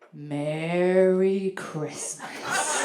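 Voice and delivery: drawn-out, tender mock-sincere greeting; very slow, heartfelt lover's murmur; gentle, loving. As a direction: stretch it out slowly, full of love. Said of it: "very gently, very lovingly".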